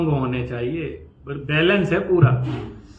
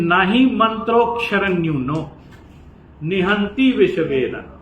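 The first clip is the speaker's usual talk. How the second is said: slow, louder recital of the Sanskrit line; verse recitation; 3 semitones higher, 4 dB louder than usual. Shown as a demonstration. Teacher citing the scripture example, slow and declaiming. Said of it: "उसका जो उदाहरण दिया".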